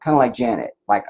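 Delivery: normal talking voice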